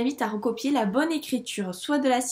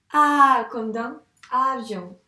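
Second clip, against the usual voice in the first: "A" held long and loud, also starting "avion"; emphasised vowel; 2 semitones higher, 9 dB louder, slower than usual. Shown as a demonstration. Teacher pointing out the sound heard, emphatic. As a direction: punch and stretch the first vowel of "avion". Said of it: "On entend le".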